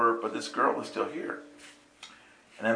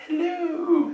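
much higher, airy, drawn-out hello; spacey hello. Shown as a demonstration. Greeting the dazed girl, high and airy. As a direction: coo it like you're floating. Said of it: "me being spacey too".

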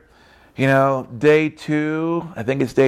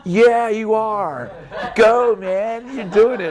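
much higher playful cheer for the dog; high cartoon dog cheering; 8 semitones higher, much slower than usual. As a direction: voice the dog egging him on, high and bright. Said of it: "my dog's going like".

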